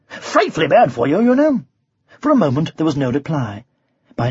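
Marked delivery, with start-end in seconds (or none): 0.1-1.6 casual, airy remark; breezy knight playing for time; light conversational tone. A narrator's reading. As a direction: toss it off as friendly advice. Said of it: "he said in a light conversational tone".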